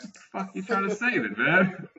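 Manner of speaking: high, quick mock outrage at a stranger; incredulous retort. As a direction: fire it back, fast and high, half joking. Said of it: "And I'm like".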